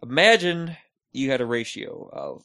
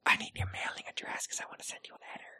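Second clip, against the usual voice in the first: very soft, hurried whisper; creepy whisper; 13 dB quieter, faster than usual. Pretend. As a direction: whisper it like a secret request. Acting out asking for an address.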